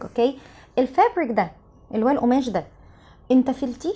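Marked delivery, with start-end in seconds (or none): none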